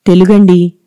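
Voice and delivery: ordinary delivery, nothing marked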